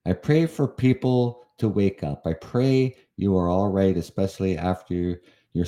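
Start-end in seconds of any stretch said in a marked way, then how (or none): none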